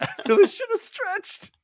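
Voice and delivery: very high, hammed-up cry of regret; mock pained wail. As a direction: squeal it as the comically injured hero. Injured hero's comic regret.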